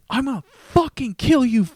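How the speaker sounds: high, loud screamed threat; retold raging driver shouting a threat; furious threat. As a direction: scream it like you mean to kill.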